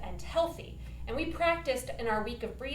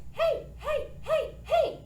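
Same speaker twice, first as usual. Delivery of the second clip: light, airy, much higher heys; head voice; slower than usual. Shown as a demonstration. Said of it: "So I'm taking my head voice".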